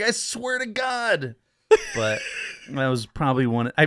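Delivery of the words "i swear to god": high, slow pleading swear. Retold or imitated. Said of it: "And I was like".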